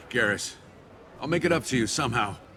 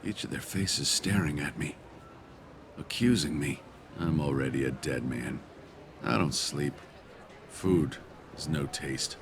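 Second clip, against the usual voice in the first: low, halting, guilt-ridden; weary, lowered voice full of remorse; haunted confession; 4 semitones lower, 4 dB quieter than usual. Acted. Guilty man confesses his torment.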